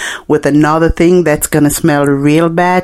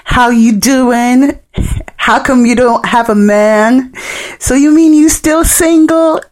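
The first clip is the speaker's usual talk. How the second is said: cheeky roach voice; 7 semitones higher than usual. Retold or imitated. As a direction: play a nosy roach gossiping at her. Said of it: "They were like".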